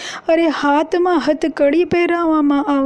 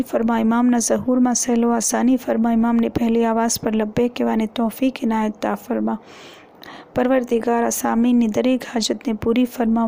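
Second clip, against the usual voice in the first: lower, fast recited dua, eyes closed in supplication; closing prayer; 4 semitones lower, faster than usual. Acted. Preacher closing the mourning gathering with a lower, fast dua. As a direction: rattle off the Arabic invocations, lower and quicker.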